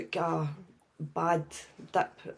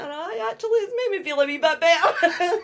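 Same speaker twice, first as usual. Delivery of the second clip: much higher, breaking into laughter; laughing admission; 10 semitones higher, 5 dB louder than usual. Retold or imitated. Admitting to her son it helped, laughing. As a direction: concede it through a laugh.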